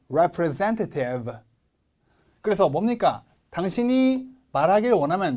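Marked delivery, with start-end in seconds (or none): 0.1-1.3 very slow "Representative" with the third syllable stressed; stress on ZEN. Shown as a demonstration. Teacher models for repeating, stressed "zen" in "Representative". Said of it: "여기에 강세가 옵니다".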